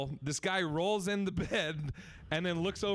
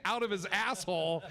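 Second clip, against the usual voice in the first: normal talking voice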